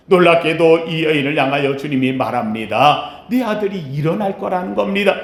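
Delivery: ordinary delivery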